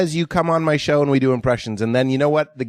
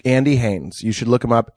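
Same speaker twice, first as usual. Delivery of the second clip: ordinary delivery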